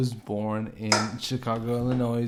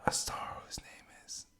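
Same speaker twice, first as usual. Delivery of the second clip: hushed, breathy-free whisper building suspense; dramatic whisper; 14 dB quieter, faster than usual. Acted. Host whispering to the audience before naming Caesar. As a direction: whisper it like a secret reveal.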